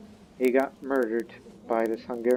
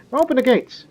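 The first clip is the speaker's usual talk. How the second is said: high, loud order to the guards; commanding shout; 13 semitones higher, 9 dB louder than usual. Acted. Lord shouting to his guards to open gates, loud commanding call.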